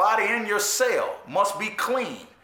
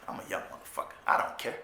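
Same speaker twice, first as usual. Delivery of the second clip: whispered aside; 9 dB quieter, faster than usual. Real, not acted. Defiant aside, dropped to whisper.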